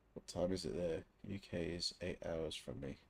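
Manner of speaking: ordinary talk, nothing marked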